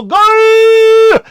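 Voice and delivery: a sudden, high, loud fan's yell; soccer cheer. Retold or imitated. Preacher mimics Mexican soccer fans cheering, loud high yell. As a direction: belt it out like a fan in the stands.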